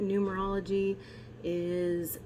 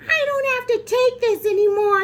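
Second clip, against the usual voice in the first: high, loud exasperated shriek; fed-up mom; 9 dB louder than usual. Retold or imitated. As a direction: screech it like a mom at her limit. Annoyed mom drives onto sidewalk.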